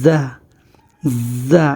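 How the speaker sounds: জা opened with a long, light z buzz; জা with a lightly buzzed z. Shown as a demonstration. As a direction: demonstrate জা as Arabic "za", holding the z. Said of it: "একটু হালকা একটু".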